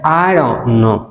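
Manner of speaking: I don't know with the t of don't dropped, in another voice; dropped t, different voice. Shown as a demonstration. Teacher contrasting how two men say it. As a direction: voice a second man saying I don't know smoothly. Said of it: "एक कहता है".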